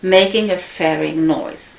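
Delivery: ordinary delivery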